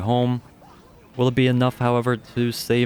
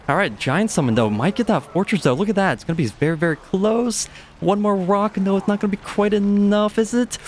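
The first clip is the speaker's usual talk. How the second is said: rising excitement; 11 semitones higher than usual. Real, not acted. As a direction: voice climbing much higher as the fight gets closer. Tense close fight in live cast.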